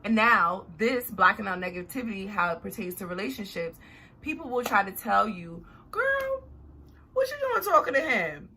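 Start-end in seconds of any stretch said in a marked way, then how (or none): 5.9-8.5 very high-pitched imitation of a nosy friend; mock a disapproving friend, pitched way up; meddling girlfriend. Friend warning her off a man, mock meddling girlfriend.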